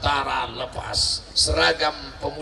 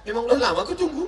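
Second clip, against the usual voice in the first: fast, higher, laughing confession; gleeful widow; 6 semitones higher, much faster than usual. Acted. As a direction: blurt it out gleefully. (istri yang lega, cepat sambil tertawa)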